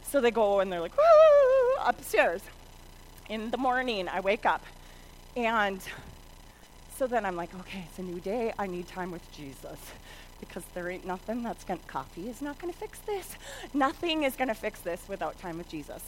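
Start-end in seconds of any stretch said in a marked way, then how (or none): 1.0-1.8 put-on high "woo" of kids fleeing; playful scurrying sound effect; imitated whoosh. 7.6-16.0 low, soft talk to oneself; acted-out subdued morning resolve; weary resolve.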